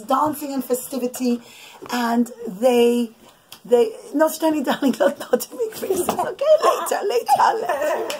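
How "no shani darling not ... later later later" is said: high, giggly telling-off through a laugh; laughing scold. Real, not acted. Mother laughing as she tells her daughter to stop making faces. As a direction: laugh while gently shooing her off camera.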